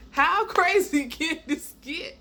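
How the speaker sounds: high, slow, loud question broken by laughter; drawn-out incredulity with a laugh inside; laughing disbelief. Retold or imitated. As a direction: crack up while marveling at the absurdity. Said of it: "I just start laughing".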